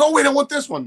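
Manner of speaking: high excited woman's voice; lady's eager grab. Retold or imitated. Eager lady grabbing the picture, high excited voice. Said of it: "She goes".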